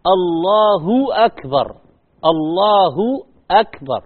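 الله أكبر slow, الله and أكبر clearly apart; careful slow الله أكبر; slow full takbir. Shown as a demonstration. Teacher showing the proper takbir, slow. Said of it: "هذا التكبير".

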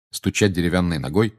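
ordinary delivery, nothing marked

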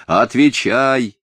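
drawn-out imperious command. A narrator's reading. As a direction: demand an answer with authority. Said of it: "властно сказал мальчик".

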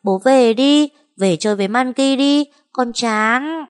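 put-on high, pleading child's voice; a small child whining to come along; little girl voice. A narrator's reading. Little Monkey begging her parent to come play, high childish whine.

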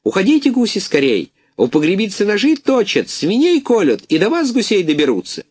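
high alarmed jackdaw call; jackdaw shouting warning. A narrator's reading. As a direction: warn the geese in a panic. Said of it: "кричит".

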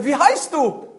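high, loud demand; calling out. Acted. Hunter to unseen voice in forest, loud call. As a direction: call out to someone hidden.

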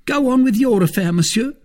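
high, loud servant's cry. A narrator's reading. Servant reports to his fighting master, crying out. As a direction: call up to your master, pitched high. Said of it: "cried Planchet".

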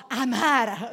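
loud, drawn-out Barese "amara"; heavy Bari accent. Shown as a demonstration. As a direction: belt out "amara" slowly in broad Bari dialect. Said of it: "un accento barese bello carico". ("amara" forte e allungato, in barese carico)

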